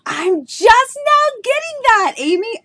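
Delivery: high, slow, amazed discovery; stunned realization. Real, not acted. Streamer realizes a viewer's identity, amazed and high. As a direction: let the penny drop out loud, slowly and high. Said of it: "my mind is blown right now".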